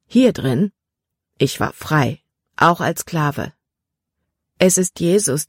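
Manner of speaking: a touch of a calm, sincere freed slave; Jordan, a lightly voiced earnest young man. A narrator's reading.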